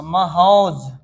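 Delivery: महौज stretched out slowly; drawn-out pronunciation. Shown as a demonstration. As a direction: let महौज drag for the class. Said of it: "क्या होगा जी?". (महौज को धीरे खींचकर बोलें)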